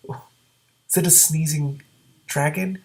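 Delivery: ordinary delivery, nothing marked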